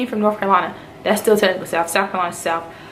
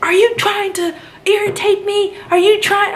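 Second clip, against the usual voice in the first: much higher, slow, mocking the instructor's irritated question; imitating an irritated instructor, much higher; 11 semitones higher, slower than usual. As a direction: mimic the exasperated instructor, pitched way up. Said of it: "He's like".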